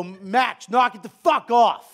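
put-on loud barked scold at a kid; the yelling dad he refuses to be; mock yell. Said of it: "I don't go".

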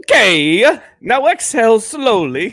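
attempted Italian accent on a meditation line. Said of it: "Do it with an Italian accent".

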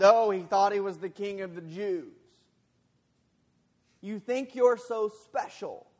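high, loud mocking jeer; sarcastic taunt. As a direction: taunt him, dripping with scorn. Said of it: "It was a sarcastic, nudge".

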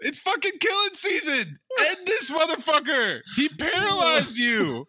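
urging the screen. Real, not acted. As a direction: egg the hero on, half laughing.

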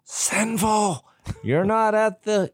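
high, mocking preachy voice; mock legalist scold. Friend mocking legalists, a high, mocking scold. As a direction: lampoon a finger-wagging churchgoer. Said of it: "They're like".

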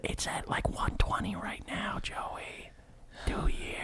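hushed whisper. Real, not acted. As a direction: whisper it like a secret. Whispers the price.

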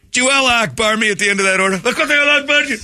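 high, fast outraged customer voice; entitled customer. Acted. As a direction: complain high and fast at the window. Angry customer at drive-thru.

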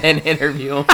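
laughing while talking. Real, not acted. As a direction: say it through laughter. Laughing at the idea.

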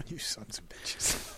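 muttered under breath; whispered. Real, not acted. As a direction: whisper the curse. Mock curse after being teased.

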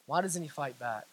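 low, very soft repeat; hushed question. Acted. Sisters watching helplessly, hushed bewilderment. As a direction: let the question sink almost to nothing.

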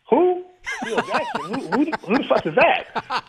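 high-pitched, baffled disbelief through laughter; incredulous. Retold or imitated. Player asked about a coach he has never heard of, incredulous. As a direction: retell being utterly clueless, half laughing. Retells his clueless reaction to name.

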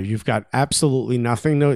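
usual speaking voice

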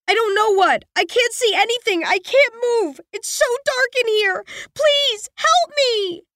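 high, rising frightened pleas; panicked girl. A narrator's reading. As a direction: beg from the dark, growing panic. Girl panics inside coffin.

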